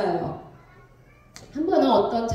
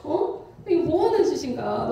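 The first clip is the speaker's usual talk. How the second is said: indignant rant, higher and louder in bursts; hammed-up fed-up venting; retold anger; 5 semitones higher, 4 dB louder than usual. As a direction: replay a fuming grumble to oneself. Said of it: "화가 막 치고 치밀어 오르는".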